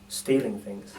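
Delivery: ordinary delivery, nothing marked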